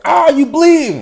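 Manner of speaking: very high, loud, drawn-out panic yell; mock panicked scream. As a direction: scream it like a useless, panicking helper. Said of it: "screaming like".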